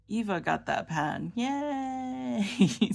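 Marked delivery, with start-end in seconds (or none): 1.4-2.9 high happy yay; excited cheer. Real, not acted. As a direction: cheer for her. Cheering for Eva's pen.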